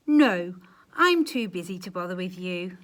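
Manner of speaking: loud, brusque brush-off; loud rude refusal. Acted. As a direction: snap him away. Gingerbread man refusing rudely.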